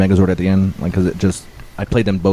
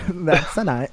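higher, softer mock-Italian accent; playful Italian accent, pitched up; 6 semitones higher, 3 dB quieter, slower than usual. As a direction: toss it off as a random Italian accent. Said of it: "you've never had a random accent".

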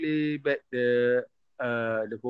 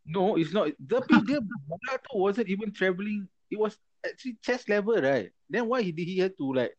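pitched up, arguing hard; heated argument; 7 semitones higher than usual. Real, not acted. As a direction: push back, worked up.

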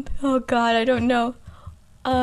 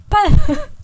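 loud, high British impersonation; Michael Caine; 8 semitones higher, 7 dB louder than usual. Acted. Guest attempting the actor's voice, loud and high. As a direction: burst out in a put-on English voice. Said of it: "I think it's Michael Caine".

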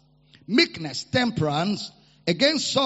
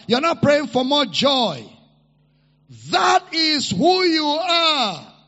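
preaching at full force; 5 semitones higher, 5 dB louder than usual. Real, not acted. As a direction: drive it home to the congregation, building to a shout. Preaching builds to a shout.

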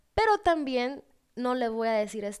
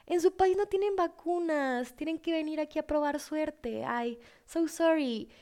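mock pity; 6 semitones higher than usual. Vaccine staff feeling sorry for the foreign traveler, mock pity. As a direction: coo over someone in exaggerated sympathy. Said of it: "fue así como, "Ay, pobrecita".

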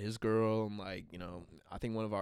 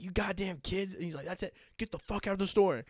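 high, retold angry telling-off; mildly mocked irate scolding; cussing manager; 6 semitones higher than usual. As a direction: imitate a manager ordering kids out. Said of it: "he's cussing at us".

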